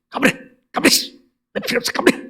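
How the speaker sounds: high, strained temper-fit yell; imitated angry screaming. Friend driving the helper away, mock screaming. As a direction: act out a friend's screeching dismissal. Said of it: "악을 쓰고 성질을 낸다".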